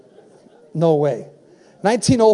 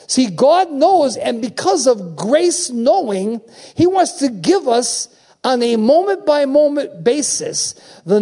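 slow, raised, punched phrases; emphatic preaching; 4 semitones higher, slower than usual. Real, not acted. Preacher tells congregation God knows his plan, emphatic. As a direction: preach it out, each phrase driven home.

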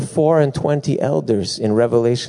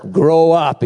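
higher, louder mock order; mock pastor's command; 6 semitones higher, 6 dB louder than usual. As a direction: bark it like the pastor, half-joking. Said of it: "and he's like".

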